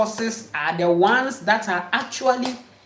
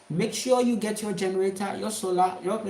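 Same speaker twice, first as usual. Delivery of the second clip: usual speaking voice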